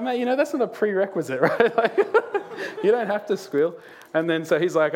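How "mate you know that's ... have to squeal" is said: words bubbling through real laughter; can't stop laughing while explaining. Real, not acted. Pastor telling the congregation a funny story, laughing.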